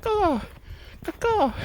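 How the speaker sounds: very high-pitched cawing; bird caw. Retold or imitated. Mimicking the youths' call, high bird cawing. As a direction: imitate a crow-like bird call. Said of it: "like a bird, like".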